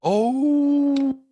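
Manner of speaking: long, high "oooh"; impressed hoot. Real, not acted. Reacting to his friend's punchline, impressed whoop. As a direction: draw out a high, loud ooh at the burn.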